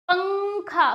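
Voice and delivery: पंखा with a drawn-out ng hum through the nose; nasal anusvara. Shown as a demonstration. Teacher showing the nose-made sound, पंखा said nasally. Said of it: "अंग का उच्चारण नाक से अधिक होता है".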